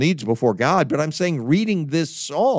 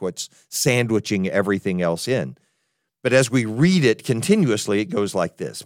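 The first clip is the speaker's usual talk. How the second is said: usual speaking voice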